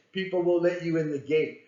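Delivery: normal talking voice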